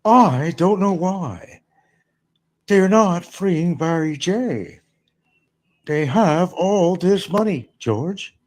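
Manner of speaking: slow sitcom Jerry musing; mock Jerry Seinfeld. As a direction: play a puzzled Jerry Seinfeld. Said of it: "Seinfeld says".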